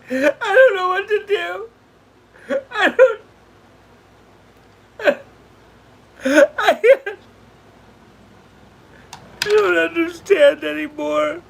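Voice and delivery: high, tearful voice breaking; sobbing confusion, barely getting words out; crying. Real, not acted. Streamer telling viewers she is lost, real crying.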